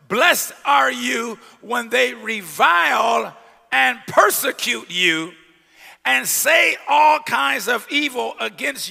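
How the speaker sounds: slow, loud, ringing scripture reading; preaching scripture. Acted. Preacher proclaiming Matthew to the church, slow and loud. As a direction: proclaim the verse to the congregation.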